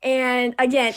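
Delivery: ordinary talk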